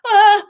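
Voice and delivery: short, high, loud angry outburst; frustrated yell. Retold or imitated. Simon loses his temper.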